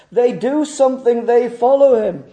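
high, loud pulpit proclamation; full-force preaching. Real, not acted. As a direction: proclaim it with rising urgency.

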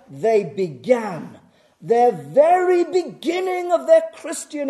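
loud, high, driving pulpit delivery; full-force preaching. Real, not acted. Preacher arguing from scripture to congregation, full-force preaching. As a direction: hammer the doctrine home at full volume.

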